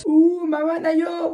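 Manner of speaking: high, slow teasing ooh; mock teasing coo. Reactor mocks the mother character.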